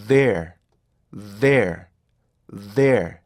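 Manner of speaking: "there" in three beats of equal length and force; three even beats. Shown as a demonstration. Teacher showing the correct three-beat "there". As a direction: give each part of "there" equal weight. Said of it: "이렇게 발음하시도록".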